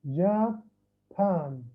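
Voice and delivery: "جابان" said slowly in two separate parts; split into syllables. Shown as a demonstration. Teacher showing students how the word divides, slow and split. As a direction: break "جابان" in two, a clear gap after جا. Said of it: "لاحظ والله كيف قرأت الكلمة".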